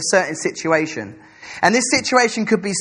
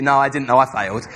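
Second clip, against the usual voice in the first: usual speaking voice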